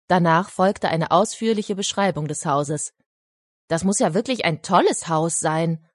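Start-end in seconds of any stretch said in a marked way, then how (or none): none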